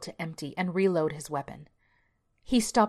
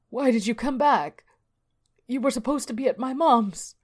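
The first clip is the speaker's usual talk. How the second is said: high, anguished wail; sobbing; 7 semitones higher, 5 dB louder than usual. Acted. Alan cradles his dead wife, sobbing lament. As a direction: plead through grief, raised voice. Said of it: "and sobbed".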